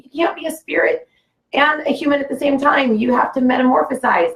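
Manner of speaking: high, urgent insistence, lightly imitated; freaking out. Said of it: "she's, like, freaking out".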